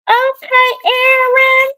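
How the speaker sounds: high, loud, teasing "Okay, Aaron"; a sing-song tease; playful pun. Real, not acted. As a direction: bounce the joke up high. Playful pun on viewer's comment.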